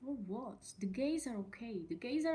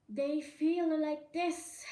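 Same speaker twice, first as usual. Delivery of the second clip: louder, higher, slow and pointed; emphatic; 6 semitones higher, 6 dB louder, slower than usual. Real, not acted. Vlogger sets up a mocking quote, loud and slow. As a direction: stretch it out in disbelief.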